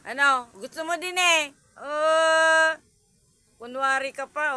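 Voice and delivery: high, loud mock scolding; loud playful scold; loud teasing. Real, not acted. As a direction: tease the cat, voice up. Teasing the cat loudly.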